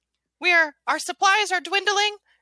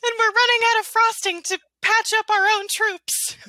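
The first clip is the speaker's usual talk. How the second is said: earnest officer reporting, higher; soldier voice; 7 semitones higher, 3 dB louder than usual. Acted. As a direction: plead for supplies like a soldier. Gingerbread officer reports to general.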